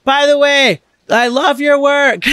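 high, loud, slow gushing yell; screaming fan. Retold or imitated. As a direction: scream it over the bar noise. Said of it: "She's, like, screaming".

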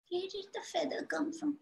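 breathy puzzled whisper to himself. Acted. Man wondering alone, whispered.

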